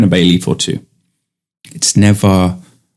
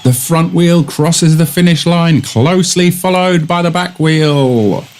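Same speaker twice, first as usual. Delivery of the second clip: raised commentary voice, stretched out; mock commentator; 7 semitones higher than usual. Comic announcer calling a bike race finish. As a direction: call it like a grand sports commentator.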